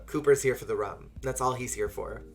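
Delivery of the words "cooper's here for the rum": usual speaking voice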